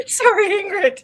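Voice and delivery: high, loud giggling apology; laughing apology. Real, not acted. Speaker apologizes while laughing.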